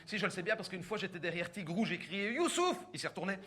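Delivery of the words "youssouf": high shouted call of a name. Retold or imitated. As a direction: call out across the crowd.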